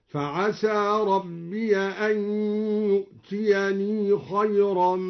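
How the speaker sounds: slow chant, ربي as rabbiya, يؤتيني with long final i; open and sounded ya. Shown as a demonstration. Teacher shows al-Duri's reading, chanting ربي as rabbiya. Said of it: "وأثبت الياء في أن يؤتيني مع فتح ياء".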